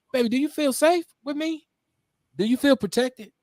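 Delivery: high-pitched, acted check-in with a partner; asking his woman. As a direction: ask your partner, voice lifted way up. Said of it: "maybe you need to ask your, your woman".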